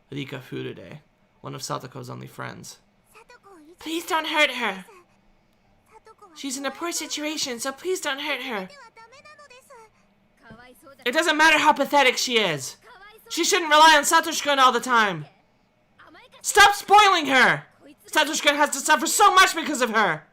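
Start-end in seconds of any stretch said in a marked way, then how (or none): 3.8-8.7 higher, slow, gentle plea; pleading friend. A narrator's reading. Rika begging narrator to stop. 11.0-20.2 angry retort. A narrator's reading. Furious girl yelling at Rika. Narrator snaps back.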